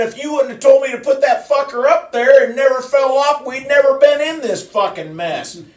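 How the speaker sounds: loud, high retold tirade; angry blame. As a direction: shout the blame back at the lineman. Retold quarrel with the lineman.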